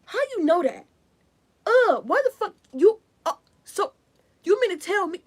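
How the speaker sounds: loud, high, sputtering mock outrage; imitated angry outburst. Retelling someone's angry reaction to being found out, loud outrage. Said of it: "this person was so mad".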